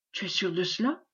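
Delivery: asked softly, in a murmur; a low, wary murmur. A narrator's reading. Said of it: "murmura Méloir". (murmuré doucement)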